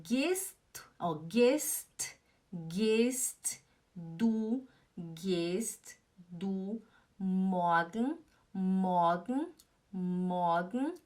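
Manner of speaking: very slow, word-by-word German; drawn-out syllables, each lifting at the end; careful model pronunciation. Shown as a demonstration. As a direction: sound it out slowly for learners. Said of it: "Atenção".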